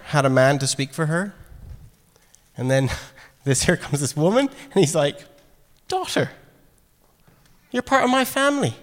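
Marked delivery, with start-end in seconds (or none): 5.9-8.7 high and tender, welcoming her in; tender Jesus voice. Retold or imitated. Jesus tenderly welcoming the woman into his family, high and gentle.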